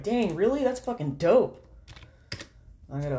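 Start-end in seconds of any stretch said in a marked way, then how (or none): 0.0-1.6 higher and louder, thrilled mock delight; pumped at the good news; excited. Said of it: "I was like".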